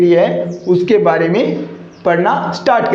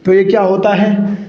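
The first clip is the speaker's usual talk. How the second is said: ordinary talk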